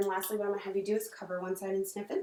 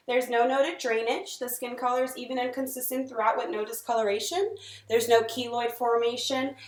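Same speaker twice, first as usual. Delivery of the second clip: clinical dictation; 5 dB louder than usual. Acted. Dictating exam findings aloud.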